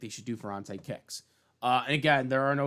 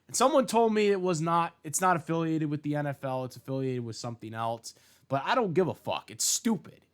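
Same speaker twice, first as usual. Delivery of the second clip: ordinary talk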